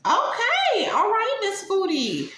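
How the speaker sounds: very high sing-out; teasing squeal. Acted. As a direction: squeal it mockingly at the vlogger. Teasing sign-off to the vlogger.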